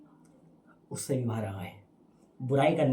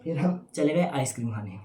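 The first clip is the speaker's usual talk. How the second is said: ordinary delivery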